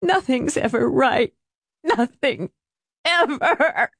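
high, broken sobbing voice; despairing high wail with pauses. A narrator's reading. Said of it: "she sobbed into her hands".

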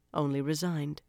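plain reading voice

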